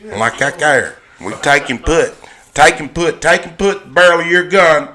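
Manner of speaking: put-on thick Georgia country accent; good old boy. Georgia good old boy showing local talk, thick Southern accent. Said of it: "a good old boy".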